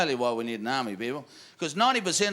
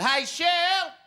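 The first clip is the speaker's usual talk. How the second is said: high Aussie holler to his missus; mock yell, starting very high; calling out; 6 semitones higher than usual. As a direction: call across the bar as an Aussie bloke. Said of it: "Called out to his missus".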